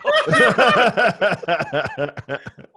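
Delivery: a long, loud peal of laughter. Real, not acted. Teller laughing at how his friend shut the man down.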